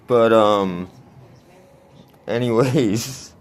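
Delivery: usual speaking voice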